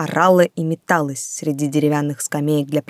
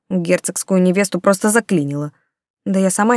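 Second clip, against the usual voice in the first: usual speaking voice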